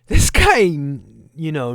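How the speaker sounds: sudden loud, high burst of exasperation; exasperated outburst. Real, not acted. Host boiling over at a man on the news, exasperated outburst. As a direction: explode on the first words, then pull back.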